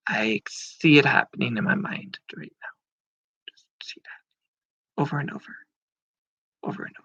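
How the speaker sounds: dreamy whisper fading away. Real, not acted. Imagining the win, fading whisper.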